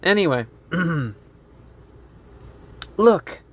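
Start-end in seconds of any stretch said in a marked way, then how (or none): 3.0-3.4 raised one-word interjection; interrupting call. Acted. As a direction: break in with a higher pitch.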